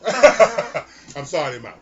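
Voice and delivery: put-on slow, laughing Jamaican accent; loud playful Jamaican accent. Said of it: "That was real Jamaican".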